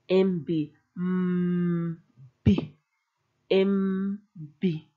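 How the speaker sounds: M then B, each sound held apart; letter sounds. Shown as a demonstration. Teacher breaks the cluster into its sounds. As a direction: hum M, then pop B. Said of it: "M with a consonant".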